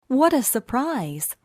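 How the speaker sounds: plain reading voice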